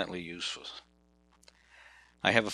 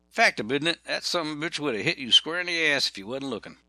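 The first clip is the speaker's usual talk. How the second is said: Fact, isn't it? run together in a Southern twang, higher; 6 semitones higher than usual. Retold or imitated.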